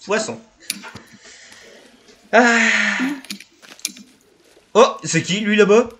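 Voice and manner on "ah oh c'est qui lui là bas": startled loud gasp, then alarmed; scared horror game streamer. Real, not acted. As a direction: jump at a sudden figure. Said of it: "il m'a fait peur le con". (surpris et effrayé)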